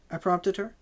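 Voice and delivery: ordinary delivery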